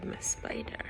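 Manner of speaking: breathy hushed apology; whisper. Real, not acted. Comforting the sad spider in the book, whispered. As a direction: whisper her name softly.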